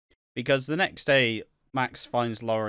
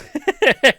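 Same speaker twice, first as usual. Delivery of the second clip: a sudden, much higher and louder cackle; real laugh bursting out high and loud; loud real laugh at her own joke; burst of laughter; 14 dB louder than usual. Real, not acted.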